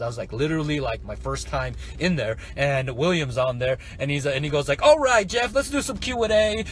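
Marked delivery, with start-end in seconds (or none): 4.8-6.6 high, loud hype-host voice; mock presenter energy; webinar host. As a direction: imitate an upbeat host launching the Q and A. Imitates webinar host on air.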